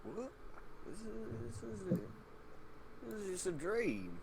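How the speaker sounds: nervous denial. Acted. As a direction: talk yourself out of it, nervously. Bilge denies being dead.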